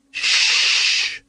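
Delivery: sharp whispered shush; hissed shush. A narrator's reading. Nobby hushing Carrot after the bell, hissed. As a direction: hiss to silence him at once. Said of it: "hissed Nobby".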